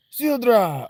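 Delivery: high, loud call; character voice. Acted. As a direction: call out her name high. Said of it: "That's not what she sounds like".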